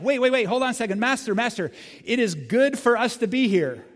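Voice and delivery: high, loud eager pleading, Master, Master; an overeager disciple begging to stay; breathless excited plea; excited Peter. Acted. Preacher acts out Peter's excited plea.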